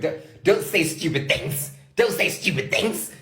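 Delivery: high-pitched, pleading mock anger; retold frustrated rant, pitched high; angry. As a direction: plead angrily, stretching the words out. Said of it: "so angry".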